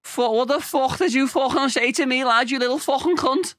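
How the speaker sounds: mock-aggressive, very high Scouse; grating, high-pitched Scouse threat; Scouse accent. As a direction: square up like an offended Liverpool lad. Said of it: "It's a bit grating".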